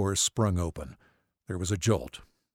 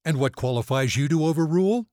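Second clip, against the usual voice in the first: raised pitch, louder, indignant; affronted ER physician; 7 semitones higher, 5 dB louder than usual. A narrator's reading. ER physician objecting to the family doctor, indignant. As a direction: assert your rank, affronted.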